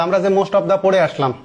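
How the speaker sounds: ordinary talk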